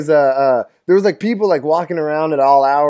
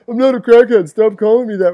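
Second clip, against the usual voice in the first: indignant mock whine; 7 semitones higher, 4 dB louder than usual. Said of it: "He's like".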